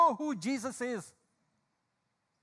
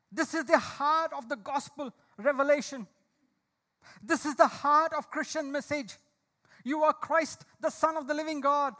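louder, urgent preaching voice; fervent preaching; 4 semitones higher, 5 dB louder than usual. Acted. Preacher urging friends to hold firm, fervent preaching. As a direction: exhort the congregation to stand firm.

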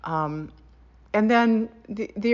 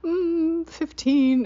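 much higher, teasing sing-song count; sister's count; 11 semitones higher, 5 dB louder, much slower than usual. Retold or imitated. Sister counting chews at the table, teasing. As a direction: draw out the number like a secret joke. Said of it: "my sister Jennifer would say".

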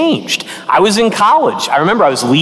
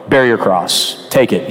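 mock blunt command; 10 semitones lower than usual. Mock blunt preacher at funerals.

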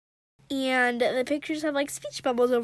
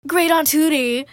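louder call to his great aunt; shouted; 2 semitones higher, 9 dB louder than usual. Acted. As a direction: call for help across the lawn. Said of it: "he shouted".

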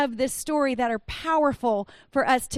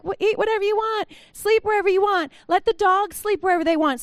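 permissive mom; 6 semitones higher than usual. Retold or imitated. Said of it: "I'm kinda like".

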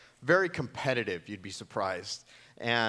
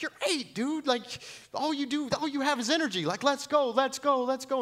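much higher, louder, pumped-up urging; dad overdoing the pep talk on a fun run; hyped-up coach; 4 dB louder than usual. Retold or imitated. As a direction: push her like an overeager coach. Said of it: "you're being too aggressive".

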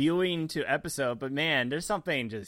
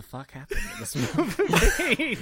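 laughing; 12 semitones higher, 5 dB louder, slower than usual. Retold or imitated. Retelling his own confusion.

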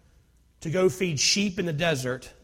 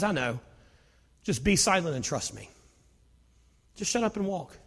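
soft God voice; 7 dB quieter than usual. Acted. God speaks calmly to Israelites.

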